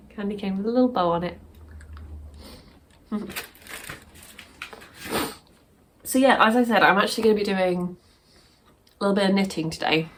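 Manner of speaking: talking with chocolate in mouth; words round a mouthful of chocolate; mouth full. Real, not acted. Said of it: "Sorry, I've got chocolate in my mouth now".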